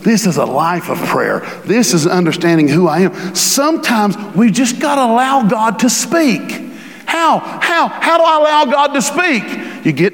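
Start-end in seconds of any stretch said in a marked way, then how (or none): 3.3-9.4 high-pitched, urgent pulpit questioning; fervent preaching. Real, not acted.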